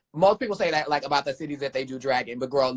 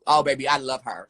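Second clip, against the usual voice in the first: ordinary delivery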